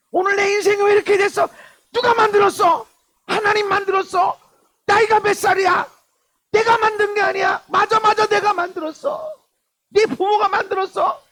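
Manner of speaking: loud, very high shouted questions; full-force preaching. Real, not acted. Preacher challenging listeners on who made their life, shouting. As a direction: fire each question at full volume, pitched right up.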